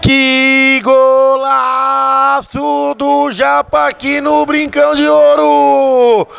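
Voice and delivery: high shouted goal call, long stretched golaço; explosive goal commentary. Real, not acted. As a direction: scream the goal, dragging out the vowels. Goal scored, commentator erupts.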